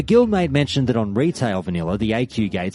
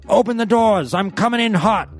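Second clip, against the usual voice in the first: high, loud radio call like a soldier; over-the-radio military voice; 11 semitones higher, 3 dB louder than usual. Retold or imitated. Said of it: "in this over-the-radio military voice".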